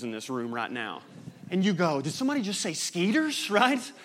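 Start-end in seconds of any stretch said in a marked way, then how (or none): 2.0-3.5 surprised. Retold or imitated. Hearing home slang abroad.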